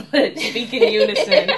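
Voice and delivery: slow, loud, laughing words; cracking up on the last words. Real, not acted. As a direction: laugh it out, drawn out. Laughing at her own joke.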